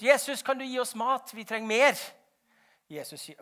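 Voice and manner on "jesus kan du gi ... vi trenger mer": urgent plea, raised and loud; higher, louder acted request; calling out. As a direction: call up to the master for more. Disciples running back, calling to Jesus.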